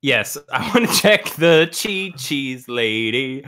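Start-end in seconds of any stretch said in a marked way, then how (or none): none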